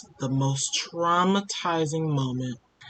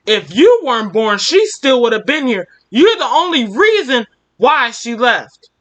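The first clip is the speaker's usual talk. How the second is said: louder, higher angry father yelling at his son; 6 semitones higher, 8 dB louder than usual. Acted. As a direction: hurl the blame at the boy. Drunk father blaming his child.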